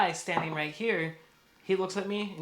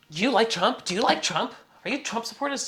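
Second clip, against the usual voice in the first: raised, louder accusatory questions; imitated accusing; 6 semitones higher, 5 dB louder than usual. Woman grilling him and the owner, imitated accusing. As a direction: fire questions like accusations, mimicking her. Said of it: "accusing us, asking us, but accusing".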